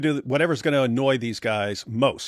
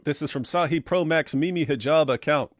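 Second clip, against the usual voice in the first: ordinary delivery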